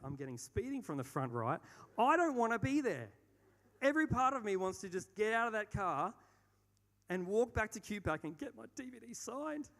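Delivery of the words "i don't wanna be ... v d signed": desperate outburst. Real, not acted. Desperate in speeding car.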